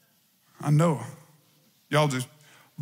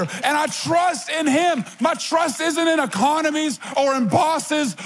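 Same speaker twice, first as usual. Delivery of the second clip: preaching at full force; 4 semitones higher than usual. Acted. Preacher to congregation, building fervent declaration of faith. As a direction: build each line higher in praise.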